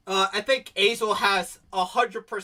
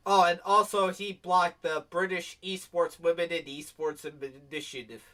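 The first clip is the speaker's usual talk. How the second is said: ordinary talk, nothing marked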